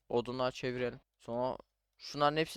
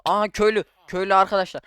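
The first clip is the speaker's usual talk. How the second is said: loud, higher cry of delight; excited discovery; 4 semitones higher, 9 dB louder, faster than usual. Real, not acted. Gamer thrilled to spot a villager, loud excited cry. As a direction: burst out at finding the villager. Spots first villager in game.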